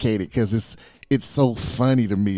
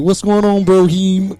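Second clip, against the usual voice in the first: higher, louder buddy greeting; guys using borrowed slang; 6 semitones higher, 7 dB louder than usual. Retold or imitated. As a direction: imitate a coworker hailing his bro. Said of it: "they'll be like".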